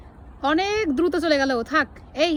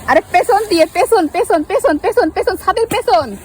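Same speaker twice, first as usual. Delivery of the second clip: loud urgent directions; calling out loud; 3 semitones higher, 8 dB louder, slower than usual. Real, not acted. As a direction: shout instructions across the water. Directing a fishing partner loudly.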